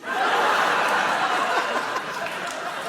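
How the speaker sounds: a high laugh with no voice in it; high breathy laugh. Real, not acted. Laughing at his own spaceship joke to the host, high giggle.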